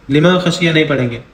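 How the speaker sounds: normal talking voice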